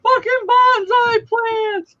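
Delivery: loud, high, slow jeering yell; mock the aunt, laughing; mocking shout. Nephew mocking his aunt, loud slow jeering shout. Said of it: "and my nephew's like".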